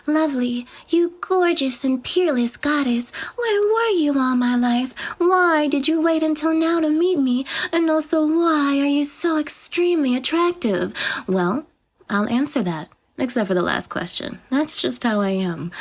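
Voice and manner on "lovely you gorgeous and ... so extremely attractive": breathless fangirl praising the goddess; mock fawning admirer. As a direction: ham up the listener's adoring thoughts. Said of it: "I know what you must be thinking".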